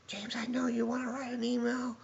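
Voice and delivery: high, hissed whisper of a furious boss; mock angry whisper. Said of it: "She's like".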